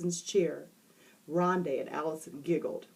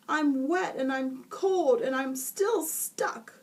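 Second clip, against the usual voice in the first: slow, breathy, higher shivering whine; miserable complaint; 8 semitones higher, 3 dB louder, slower than usual. A narrator's reading. Drenched boy complaining, miserable whine.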